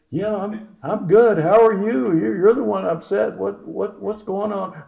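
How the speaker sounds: high-pitched, flustered phone patter; doctor acting out his own nerves, high; acted nervous reply. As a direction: pitch it up and stumble over the words. Nervously takes upset patient's call.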